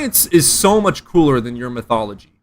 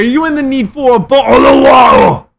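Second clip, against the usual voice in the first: shouted fake British accent, much higher and louder; shouted mock British; 7 semitones higher, 7 dB louder than usual. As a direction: yell it in a hammed-up British voice. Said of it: "I can't do a British accent".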